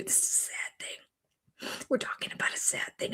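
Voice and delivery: hushed, fast, mock-sad whisper; mock solemn whisper imitating Robin. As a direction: whisper it like a fake mourner. Mocking Robin's put-on sadness.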